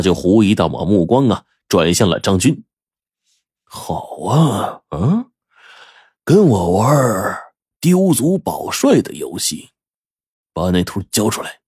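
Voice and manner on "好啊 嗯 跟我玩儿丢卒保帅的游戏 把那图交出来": suspicious gang boss. Acted. Boss suspects Zhang Jun.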